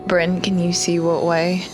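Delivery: ordinary talk, nothing marked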